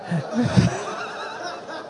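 a silent, high, airless burst of laughter; high breathless laugh. Real, not acted. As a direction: laugh in disbelief at the news. Laughing at the neighbor's call.

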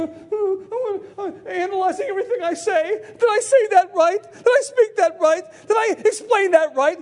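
high-pitched, exaggerated self-doubt; mock insecure. Preacher acting out second-guessing what he said, mock insecure. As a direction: fret over every word in a put-on anxious voice. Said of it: "I don't stand insecure".

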